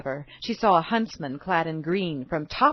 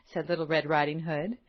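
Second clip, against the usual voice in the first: ordinary delivery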